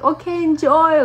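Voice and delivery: high, loud, hammed-up send-off; mock your own awkward high-pitched farewell; awkward cheeriness. Speaker to the rapper with his juice, awkwardly chirpy. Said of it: "the way I said it was just so".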